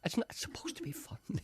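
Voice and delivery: hushed, breathy repeat; half-laughing whisper. Real, not acted. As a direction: whisper it under your breath. Repeating to a deaf listener.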